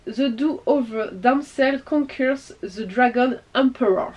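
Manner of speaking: slow, clumsy English accent of a French speaker; bad English accent. Real, not acted. Vlogger apologising while reading an English manga title, in a clumsy French-accented English accent. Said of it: "désolée pour mon accent anglais pourri".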